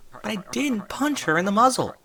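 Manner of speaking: indignant filly protest. A narrator's reading. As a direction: plead your innocence. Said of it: "protested Dinky".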